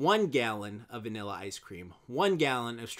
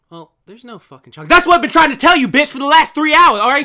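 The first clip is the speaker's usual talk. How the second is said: screamed at full force, much higher and louder; furious screaming customer; 11 semitones higher, 17 dB louder than usual. Acted. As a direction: explode at the clerk after holding back. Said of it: "And the woman says".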